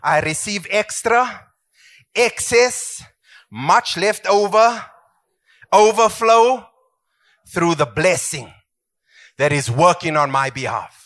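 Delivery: slow, lifted declaration of faith; the pastor leads the church in a slow declaration; congregation confession. Acted. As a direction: proclaim each word of blessing. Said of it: "Say that".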